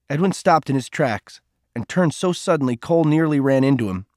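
ordinary delivery, nothing marked

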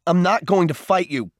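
high, loud cry under blows; shouted refusal. A narrator's reading. Boy being kicked refuses to fight back, shouting. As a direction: shout it out in protest. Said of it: "Cole shouted".

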